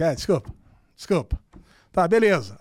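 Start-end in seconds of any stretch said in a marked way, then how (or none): 0.0-1.4 low mumbled mock apology; lightly voiced sheepish lad, pitched down; grudging boy. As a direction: imitate a kid made to say sorry. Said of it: "Aí tá o moleque".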